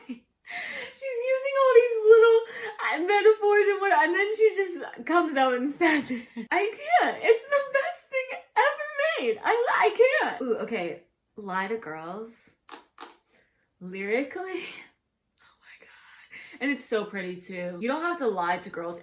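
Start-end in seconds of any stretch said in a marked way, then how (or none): 0.1-10.4 high, loud, teary squealing half-laugh; breaking into real giddy sobs; overcome squeal. Real, not acted. Overwhelmed by a favourite song. 15.4-16.6 breathless whispered awe; hushed, stunned whisper; awed whisper. Real, not acted. Awed by the song.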